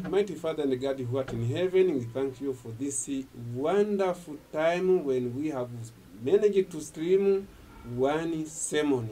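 ordinary delivery

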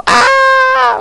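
sudden high shrieking ah, imitating a panicked maid; little mock shriek; mock scream. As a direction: shriek high like a startled cleaning lady.

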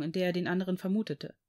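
usual speaking voice

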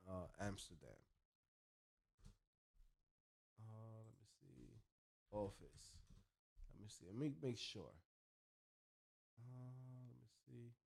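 barely audible murmur while searching; absent-minded self-talk. Real, not acted. Vlogger checking for an Amsterdam office, absently murmuring to himself. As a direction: mumble to yourself as you look something up.

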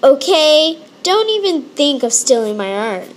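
ordinary talk

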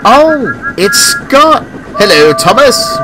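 high, loud cheerful call; happy greeting. A narrator's reading. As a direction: greet them brightly as a happy engine. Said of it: "whistled Scott happily".

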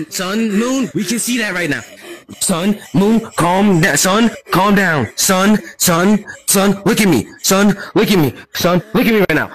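loud, firm orders to calm down. Acted. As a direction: hold his gaze and command him loudly. Calming a panicking character loudly.

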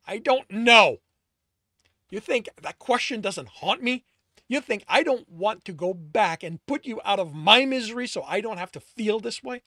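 loud, high angry yelling; yelled back. A narrator's reading. Alex yells back at Tristan in thick anger. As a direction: hurl the words back in fury. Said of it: "Alex yelled back, his anger thick".

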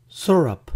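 "syrup" as SUR-up; quiz option pronunciation. Shown as a demonstration. Teacher offers a choice for pancake syrup, "syrup" as SUR-up. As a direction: say "syrup" with "sur" as first syllable. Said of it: "¿cómo se pronuncia en inglés?".

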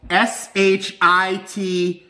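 slow, letter by letter; spelled out slowly. Acted. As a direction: spell it out deliberately. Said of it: "spelled out slowly".

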